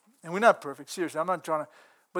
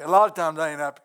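ordinary talk, nothing marked

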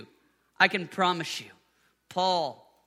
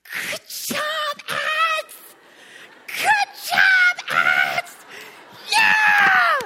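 put-on high, loud cheering for a toddler; cheering shout; 8 dB louder, much slower than usual. Father cheering his daughter who fell, high shout. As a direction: cheer her on like a proud dad. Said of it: "I got down, I looked at her".

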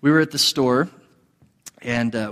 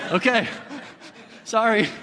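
laughing apology; 7 semitones higher than usual. Retold or imitated.

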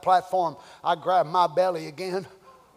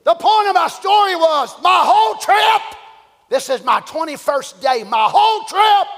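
much higher, louder shouted preaching; full-force preaching; 10 semitones higher, 7 dB louder than usual. Real, not acted. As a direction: drive the point home to the church.

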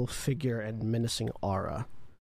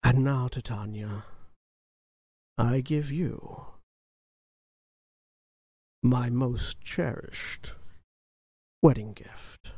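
villain voice; 3 semitones lower than usual. Acted. GM voices Valkorion's villain speech.

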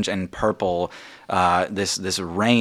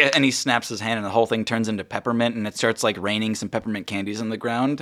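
ordinary talk

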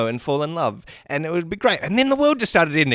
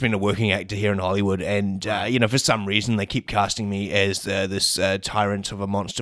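Thanos; 9 semitones lower than usual. Acted. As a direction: put on a big low Mad Titan voice. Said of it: "interview with Robert Downey Junior and Thanos".

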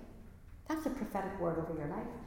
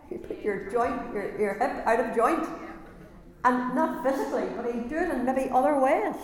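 louder, building preacher's call; rising preaching; 3 semitones higher, 5 dB louder than usual. Real, not acted. Preacher builds louder to his listeners. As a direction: drive it home to the congregation.

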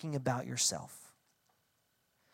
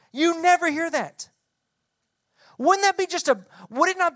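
emphatic preaching; 9 semitones higher, 4 dB louder than usual. Real, not acted. Preacher exclaiming.

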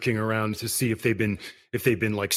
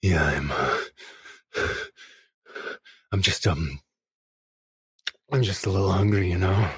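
lower, strained hungry voice; hungry vampire; 4 semitones lower than usual. Acted. Starving vampire answering the friend, low and strained. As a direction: hold back the hunger.

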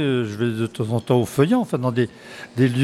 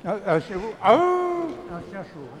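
mock surprise; 7 semitones higher, 4 dB quieter, slower than usual. Joking hot dog translation.

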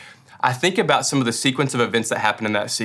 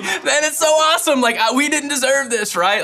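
awed gratitude. Retold or imitated. Remembered awed thought.

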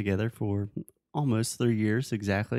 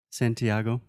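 ordinary talk

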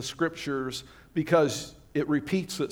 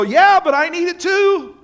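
much higher and louder whiny plea; pleading believer; 13 semitones higher, 9 dB louder than usual. Retold or imitated. A believer whines to God, high and loud, that they need healing too. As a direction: cry out that you need it too. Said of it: "you go".

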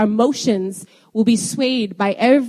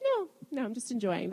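soft, unbothered reply; 12 dB quieter than usual. Retold or imitated. Acts her soft, unbothered reply at meetings.

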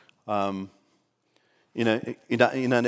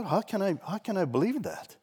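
high, softer teasing question; mock incredulity; 9 semitones higher, 4 dB quieter than usual. Pastor pretending not to believe the lady, soft teasing. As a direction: act unconvinced, lightly mocking.

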